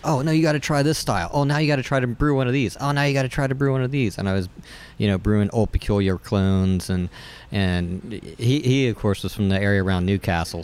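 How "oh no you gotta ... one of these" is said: enthusiastic English roommate. Retold or imitated. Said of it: "he was really influential in, like".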